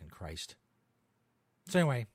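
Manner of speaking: normal talking voice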